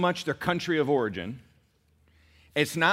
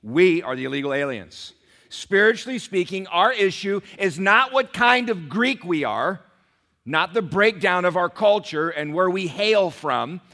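loud, high preacher's proclamation; full-force preaching; 5 semitones higher, 6 dB louder than usual. Real, not acted. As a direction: drive the point home to the congregation, voice raised. Preacher builds to climax.

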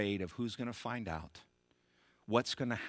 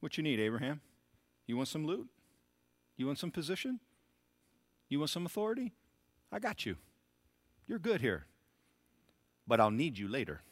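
lightly voiced smooth dealmaker, a bit higher; preacher playing the devil bargaining with Abraham; the enemy's offer; 6 semitones higher than usual. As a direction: offer loot and position like a slick dealer. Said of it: "that's how the enemy works".

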